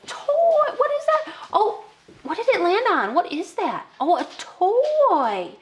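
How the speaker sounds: very high, drawn-out wonder; child-directed excitement. Shown as a demonstration. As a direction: play up the surprise for a child. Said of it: "So you're gonna say".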